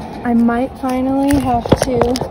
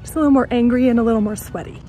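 ordinary talk

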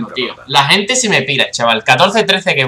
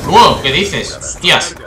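startled exclamation; 3 semitones higher, 4 dB louder, much slower than usual. Real, not acted. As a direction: blurt it out, startled. Startled by event in game.

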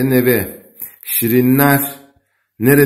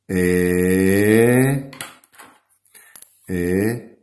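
dictating; 2 semitones lower, much slower than usual. Shown as a demonstration. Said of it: "Neymiş?".

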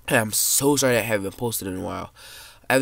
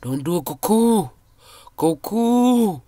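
loud, high desperate plea; pleading yell; 8 semitones higher, 7 dB louder, slower than usual. Real, not acted. Kid gaming, begging a chasing player not to eat him, yelling. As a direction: beg at the top of your voice.

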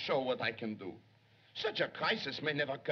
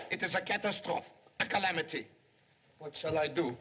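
usual speaking voice

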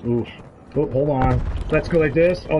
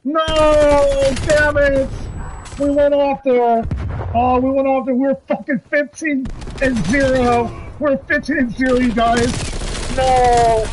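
furious shouting; 10 semitones higher, slower than usual. Real, not acted. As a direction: yell in real frustration at dying. Dies just before the nuke.

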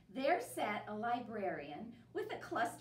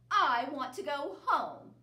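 huffy demand; 5 semitones higher, 9 dB louder than usual. Acted. Bear sulking.

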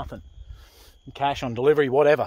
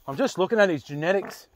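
ordinary delivery

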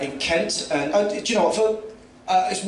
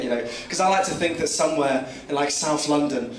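ordinary talk, nothing marked

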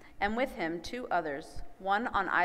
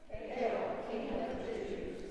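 soft stage whisper; whispered salute of soldiers; whispered soldiers' hail; 3 semitones higher, 5 dB quieter, slower than usual. A narrator's reading. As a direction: hail him in a breathy whisper. Soldiers mock Jesus, whispered.

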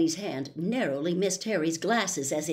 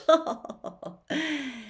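hearty showman laugh; 9 semitones higher than usual. Acted. Lockhart laughs.

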